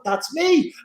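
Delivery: high, pleased little exclamation; retold thrill, pitched right up; delighted recognition. Actor seeing the Northern accent role, delighted recognition.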